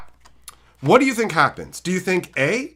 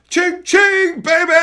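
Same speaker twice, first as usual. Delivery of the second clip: high, loud triumphant shout; gleeful cheer; 12 semitones higher, 7 dB louder, slower than usual. Retold or imitated. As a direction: crow it like you hit the jackpot. Celebrating the free cards.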